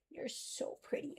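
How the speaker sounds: slow whispered praise to pet. Real, not acted. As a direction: whisper softly to the dog. Talking to her dog.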